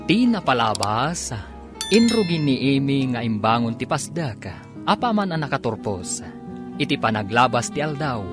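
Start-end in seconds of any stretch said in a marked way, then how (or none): none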